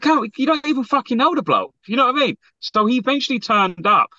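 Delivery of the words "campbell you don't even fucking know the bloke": high, incredulous outburst at Campbell; exasperated retort. Retold or imitated. Telling Campbell off about the stranger, incredulous and high. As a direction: pitch it up in disbelief.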